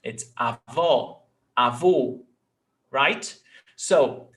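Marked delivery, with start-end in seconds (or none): 0.4-1.2 avó with a strong, open final vó; stress on last syllable. Shown as a demonstration. Teacher correcting it, avó stressed at the end. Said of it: "One is stronger". 1.6-2.2 Avô with a strong, closed final vô; stress on last syllable. Shown as a demonstration. Teacher giving the grandfather word, Avô stressed at the end. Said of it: "it will be the stressed syllable".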